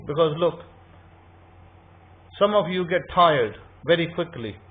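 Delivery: normal talking voice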